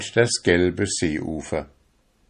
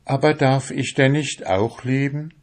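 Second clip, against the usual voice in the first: higher, wistful question; the soul asking; 7 semitones higher than usual. A narrator's reading. The soul asks whether it may love too, wistful. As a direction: ask longingly. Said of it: "fragte seine Seele".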